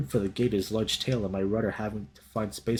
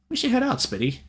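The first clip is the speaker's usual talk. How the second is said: high, light fox character voice; 12 semitones higher than usual. Acted. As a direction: lift the voice for the small fox.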